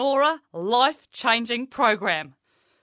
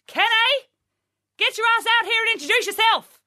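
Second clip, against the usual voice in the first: much higher, loud yell offstage; calling out; 11 semitones higher, 7 dB louder than usual. Acted. Coach yelling for cousin Kenny offstage, loud and high.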